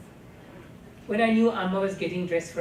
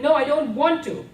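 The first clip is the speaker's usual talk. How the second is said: loud, high panicked refusal; a terrified child resisting being dragged off; frightened cry; 10 semitones higher, 10 dB louder than usual. A narrator's reading. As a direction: cry out to get away. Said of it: "I cried".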